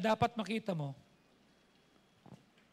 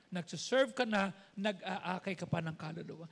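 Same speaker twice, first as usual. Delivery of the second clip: ordinary talk, nothing marked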